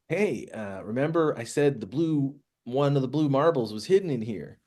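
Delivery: swooping pitch, lifting into the question; Ragnu. Acted. GM speaks as Ragnu.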